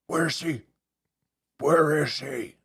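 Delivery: lightly voiced Batman; brooding hero voice, lightly done. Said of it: "No Batman reference?".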